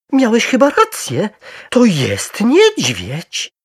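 loud, high, slowing in wonder; astonished exclamation. A narrator's reading. Wife to husband on seeing the bear, amazed. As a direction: concede it in high-pitched amazement. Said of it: "zawołała".

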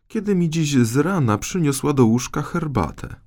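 smooth over the king's words sweetly; queen interrupting. Acted. Queen cuts the king off. (królowa, lekko wyższym głosem)

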